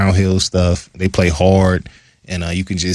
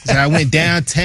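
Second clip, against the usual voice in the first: higher-pitched mock Yinzer accent; Jaquan Brisker; 7 semitones higher than usual. Teasing a teammate's hometown talk, mock Yinzer accent. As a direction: imitate a Pittsburgh Yinzer, pitched up. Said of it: "He's got a very Yinzer, Yinzer-fied accent".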